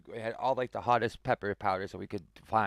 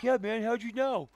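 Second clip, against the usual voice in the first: much higher, dopey mock voice; clueless dude voice; 12 semitones higher than usual. Clueless coworker answering about the gas hose, high dopey voice. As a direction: play him oblivious and chipper.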